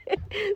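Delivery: short soft giggle; a brief amused laugh slipping out. Real, not acted. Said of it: "stop laughing". (हल्की सी हँसी)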